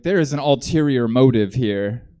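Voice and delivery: usual speaking voice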